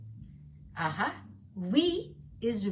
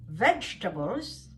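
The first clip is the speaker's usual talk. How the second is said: normal talking voice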